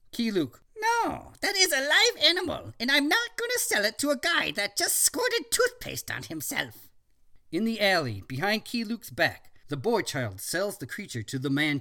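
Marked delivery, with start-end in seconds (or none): 0.8-6.9 put-on much higher, creaky old shopkeeper; high old man voice. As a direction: play a testy ancient shopkeeper.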